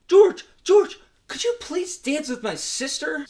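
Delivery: high-pitched mock whiny request; hammed-up high voice of a scrawny kid; scrawny guy pleading. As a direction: call out high, then plead like a little pest. Voicing scrawny guy begging a favour.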